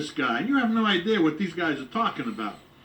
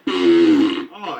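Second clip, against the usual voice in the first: fart sound; 11 semitones lower, 10 dB louder than usual. Acted. Fart bit again.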